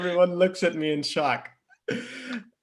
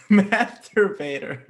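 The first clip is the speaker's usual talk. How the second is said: higher, slow repeat of the punchline through laughter; laughing; 5 semitones higher, 4 dB louder, slower than usual. Real, not acted. Reader repeating the nickname from the story, laughing. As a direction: savor the word while cracking up.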